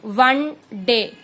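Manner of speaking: वन डे slowly, opening on a clear व; starts with w sound. Shown as a demonstration. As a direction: stress the व at the start of वन. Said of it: "it's like व". ("वन" की शुरुआत व की आवाज़ से)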